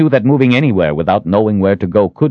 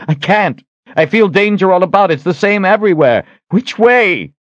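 high, louder desperate outburst; panicked; 13 semitones higher, 4 dB louder than usual. A narrator's reading. Surrounded by danger and unable to find the way, panicked. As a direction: cry out in rising desperation, pitched high.

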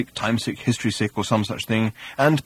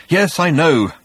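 agitated; 6 semitones higher, 5 dB louder, slower than usual. A narrator's reading. Slartibartfast hurrying, agitated.

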